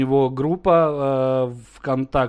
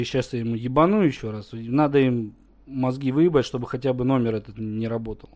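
usual speaking voice

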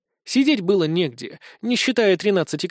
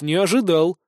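usual speaking voice

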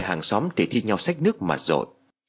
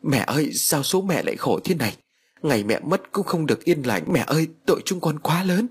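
higher, mild wailing cry to mother; wailing lament; 7 semitones higher than usual. A narrator's reading. Cô Thoan wails over her mother's burnt coffin, mild wailing. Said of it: "mà gào khóc".

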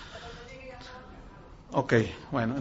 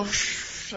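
soft voiceless whoosh; imitated commotion; 12 dB quieter than usual. Preacher imitates the uproar heard from his apartment, soft whooshing noise. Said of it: "oí un alboroto".

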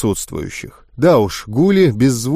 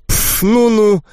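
higher dismissive sniff; snooty scoff; 8 semitones higher, slower than usual. Acted. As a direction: turn up your nose at him. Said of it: "вздёрнула носик волшебница". (фыркнуть свысока)

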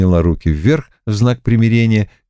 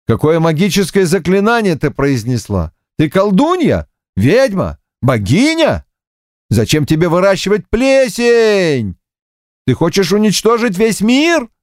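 frantic alarmed questions; 10 semitones higher than usual. Acted. Monkey king frantic through door.

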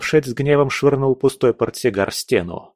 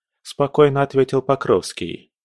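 ordinary delivery, nothing marked

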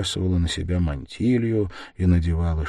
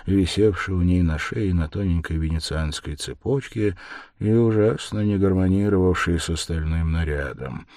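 plain reading voice

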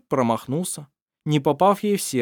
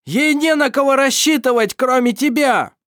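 furious shout, much higher and louder; angry yell; 13 semitones higher, 8 dB louder than usual. A narrator's reading. Irovar still screaming at Mar, furious. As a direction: keep roaring at him.

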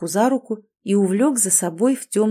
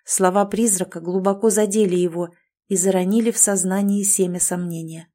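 plain reading voice

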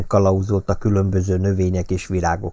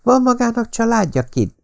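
put-on young woman's question. A narrator's reading. Patricia asks the gardener.

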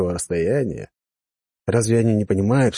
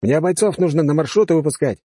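annoyed officer; 7 semitones higher than usual. Acted. Officer objects.

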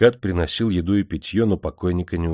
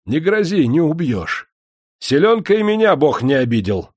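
much higher, louder angry retort; angry raised voice; 9 semitones higher, 6 dB louder than usual. A narrator's reading. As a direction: raise your voice in fury. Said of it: "повысил злой голос".

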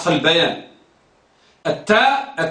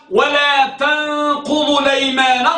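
measured, higher, sustained recitation; Quran recitation; 4 semitones higher than usual. Acted. Teacher quotes Surat an-Nahl as proof, reciting.

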